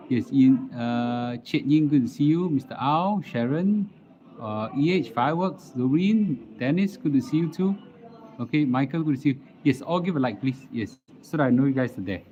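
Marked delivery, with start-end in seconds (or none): none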